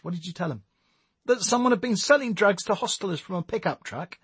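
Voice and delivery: high-pitched character voice, insistent; Nicole explaining herself. Acted. Nicole telling Tommy about her plan, insistent.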